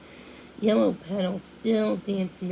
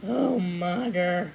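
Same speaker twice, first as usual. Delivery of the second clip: slow, annoyed grumble; cross mutter; 3 dB louder, much slower than usual. A narrator's reading. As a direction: grumble it under your breath at mother. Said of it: "Cornelius muttered crossly".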